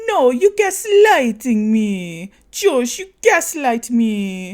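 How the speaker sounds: slow, loud, high accusation; mock Natalie. Natalie accusing Josh of gaslighting, slow loud high voice. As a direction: drag it out accusingly.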